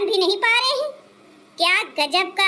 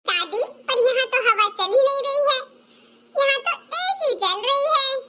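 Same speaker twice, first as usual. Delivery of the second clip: put-on little child voice; 7 semitones higher than usual. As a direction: play a small child giggling at aunty's short dress. Child corrects grandma. (छोटे बच्चे की ऊँची आवाज़)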